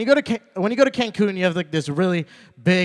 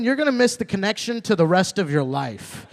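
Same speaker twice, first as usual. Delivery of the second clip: ordinary delivery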